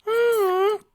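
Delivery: put-on tiny squeaky child voice, very high; happy little filly. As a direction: chirp it like an eager small foal. Dinky voice.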